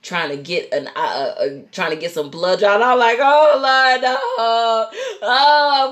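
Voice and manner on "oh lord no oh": loud, high comic wail; mock crying. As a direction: wail it like exaggerated sobbing. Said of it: "I'm crying. I'm sad".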